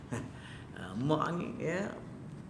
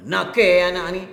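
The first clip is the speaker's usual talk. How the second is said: mock scolding mother; 5 semitones higher, 10 dB louder than usual. Mother scolding her child.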